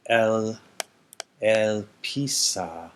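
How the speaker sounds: "L, Pisa" drawn out syllable by syllable; slow teaching read-out; slow form read. Shown as a demonstration. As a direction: lay out each piece of the form slowly. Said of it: "spells out aorist form".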